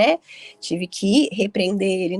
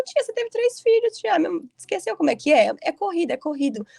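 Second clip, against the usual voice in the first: her own reply pitched way up, teasing; high teasing retort; 8 semitones higher than usual. Retold or imitated. Telling her aunt who had three kids, high teasing retort. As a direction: tease the aunt lightly, in a high playful voice. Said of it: "Aí eu falo".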